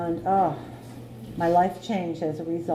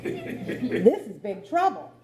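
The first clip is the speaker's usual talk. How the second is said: laughing; 8 semitones higher, much slower than usual. Retold or imitated. Retold panicked thought, laughing.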